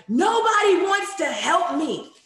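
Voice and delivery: put-on high, loud, slow whine of an upset little girl. A girl who slapped someone complains to her teacher, high whiny voice. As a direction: play the frustrated kid, voice up high. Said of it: "she was like".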